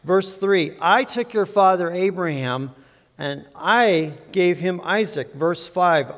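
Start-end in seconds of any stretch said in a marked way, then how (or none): 0.8-5.3 "I" pressed each time it opens a clause; scripture read with "I" stressed; emphasis on I. Shown as a demonstration. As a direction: read it leaning on every "I". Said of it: "with some emphasis on the word "I".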